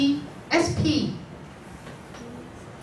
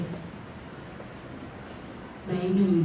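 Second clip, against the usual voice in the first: husband's whisper; 4 semitones lower, 8 dB quieter, much slower than usual. Retold or imitated. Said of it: "He said".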